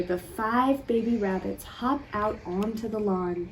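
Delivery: plain reading voice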